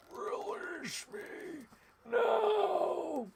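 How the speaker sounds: high, stretched alien screech. Retold or imitated. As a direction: cry out like the movie alien. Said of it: "when doing the alien voice".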